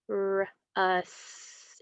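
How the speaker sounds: RUST split as rrr... usss-t; sounded out. Shown as a demonstration. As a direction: stretch RUST slowly, pausing after the r. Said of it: "It's gonna be".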